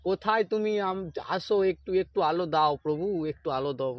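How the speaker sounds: ordinary delivery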